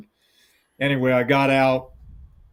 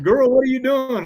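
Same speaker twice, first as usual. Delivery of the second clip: surprised; 11 semitones higher, 5 dB louder than usual. Retold or imitated. Father finds armed daughter.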